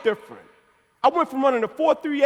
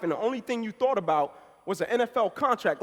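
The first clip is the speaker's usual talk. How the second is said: normal talking voice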